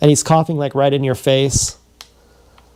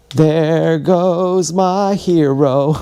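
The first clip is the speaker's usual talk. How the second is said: mock dreamy; 3 semitones higher, much slower than usual. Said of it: "I remember feeling like".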